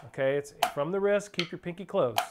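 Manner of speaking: ordinary talk, nothing marked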